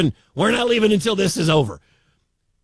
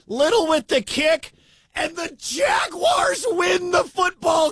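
higher, slow, roaring game-winning call; ecstatic shout; 8 semitones higher, slower than usual. Real, not acted. Radio announcer calling the winning kick to listeners, ecstatic higher roaring shout. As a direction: scream it as the winning kick goes through.

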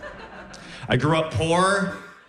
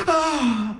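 startled awake; 10 semitones higher than usual. Retold or imitated. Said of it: "she wakes up and she goes".

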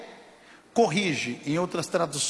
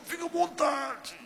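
very high, softer strangled squeak; voice failing from pain; 12 semitones higher, 5 dB quieter than usual. Retold or imitated. Injured pastor accepting a prayer, voice failing in pain. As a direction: imitate a voice that won't come out. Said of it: "A voz já não saía".

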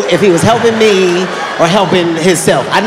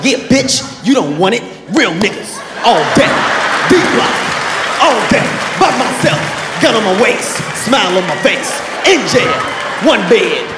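cocky shouted victory rant, rising higher to the end; gloating celebration; 3 semitones higher than usual. Retold or imitated. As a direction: taunt him after the punch. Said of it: "I celebrated".

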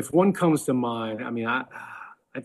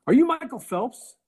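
high, louder imitation of an excited cashier; mock starstruck asking; 12 semitones higher, 5 dB louder than usual. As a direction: act out a fan's eager question. Acted cashier asking the customer.

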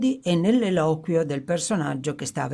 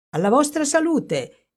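high, bright cry of a toast; sudden cheerful toast, pitched well up; exclaimed toast; 10 semitones higher than usual. Acted. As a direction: raise the glass and call it out high. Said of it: "esclamò".

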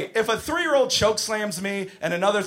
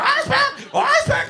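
very high shrieked demand; screaming little girl; 5 dB louder than usual. Retold or imitated. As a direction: imitate a little girl's insistent shriek. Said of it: "Little girl screaming in my face".

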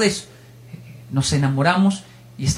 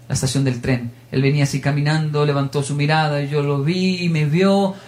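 ordinary delivery

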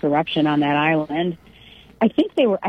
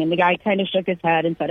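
usual speaking voice

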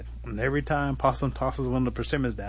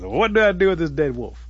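high, loud plaintive holler; acted long-distance call; calling for advice; 6 semitones higher, 8 dB louder than usual. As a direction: shout a helpless question up the tree. Turtle calls up for advice.

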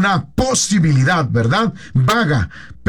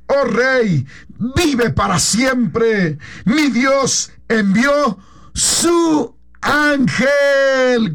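slow, crying voice with a higher pitch; tearful reply; 5 semitones higher, much slower than usual. Acted. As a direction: answer the king through tears, very slowly. Daniel answers the king. (Daniel responde al rey desde el foso, con voz llorosa y muy lenta)

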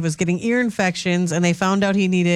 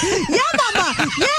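put-on excited toddler; 10 semitones higher, faster than usual. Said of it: "Matt was like".

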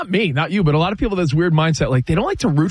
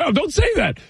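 alarmed dad; 13 semitones higher than usual. Retold or imitated. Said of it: "and I'm like".

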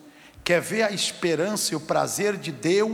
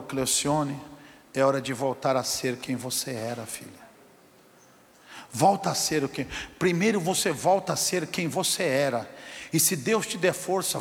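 gentle mother; 5 semitones lower, 4 dB quieter than usual. Retold or imitated. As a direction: softly urge her back to God. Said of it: "A mãe dela falou". (mãe crente aconselhando, voz baixa e mansa)

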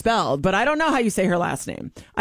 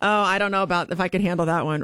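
normal talking voice

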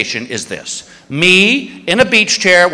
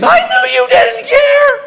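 very high, slow, mock-sobbing wife wail; hammed-up tearful wail; wife bursting into fake tears, hammed-up; mock crying wife; 4 dB louder, slower than usual. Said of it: "Here comes the tears".

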